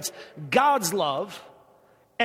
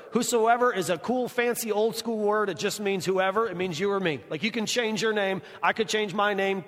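usual speaking voice

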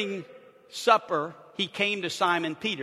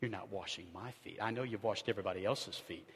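smug quiet pride; 8 semitones lower, 10 dB quieter than usual. Retold or imitated. Peter's prideful inner voice.

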